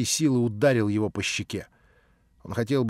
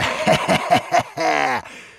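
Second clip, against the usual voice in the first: nasty laugh; 4 semitones higher, 4 dB louder than usual. A narrator's reading. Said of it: "отвратительный хохот его".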